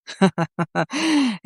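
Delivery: short amused laugh; a quick mocking chuckle. A narrator's reading. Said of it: "وقعد يضحك ويقول". (ضحكة ساخرة قصيرة)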